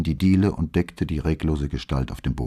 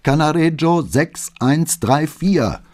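slow, high and urgent; slow pleading phone voice, higher; urgent address; 9 semitones higher, 4 dB louder, slower than usual. Acted. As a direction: spell out the address desperately. Flavia urgently gives address on phone.

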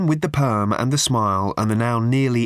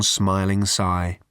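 usual speaking voice

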